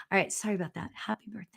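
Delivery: unvoiced whisper of an apology; whispered aside. Real, not acted. Aside apology, whispered after greeting.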